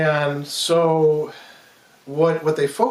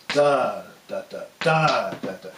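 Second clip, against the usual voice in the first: first "da" held long, next two short and dropping away; accent on first beat; 4 semitones lower than usual. Shown as a demonstration. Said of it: "If we're doing it like that".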